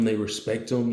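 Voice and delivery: plain reading voice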